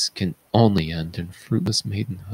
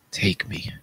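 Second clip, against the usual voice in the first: sigh, then a soft whisper; sighing surrender; 5 dB quieter than usual. Acted. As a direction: give up with a sigh. Oedipus gives in to exile.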